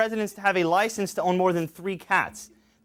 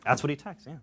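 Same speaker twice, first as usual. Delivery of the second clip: usual speaking voice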